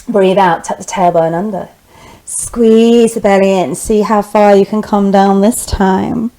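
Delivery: normal talking voice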